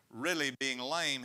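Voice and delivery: usual speaking voice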